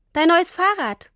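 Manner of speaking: put-on high-pitched, proud child's announcement; beaming little girl's delight. A narrator's reading. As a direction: present it joyfully, pitch lifted. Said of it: "sagte Tini strahlend".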